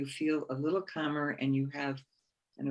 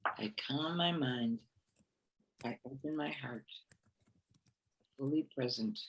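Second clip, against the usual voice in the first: meditation mantra; 5 dB quieter than usual. Shown as a demonstration. Alone in prayer, soft meditation voice. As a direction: breathe these words out calmly. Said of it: "leads a meditation mantra".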